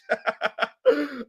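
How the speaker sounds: high-pitched cackle; long high burst of laughter; real laugh. Real, not acted. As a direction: crack up at a chat message. Cracking up at chat message.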